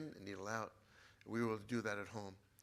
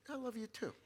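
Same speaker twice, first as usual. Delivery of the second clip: much higher, comic mimicry; imitated father's reply; 10 semitones higher than usual. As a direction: mimic dad tossing it back. Imitates father's reply again, laughter.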